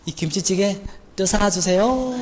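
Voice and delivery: put-on careful Seoul-accented request, drawn out at the end; 서울말 흉내. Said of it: "서울말 잘 썼어. 완벽해". (끝을 길게 끄는 어색한 서울말)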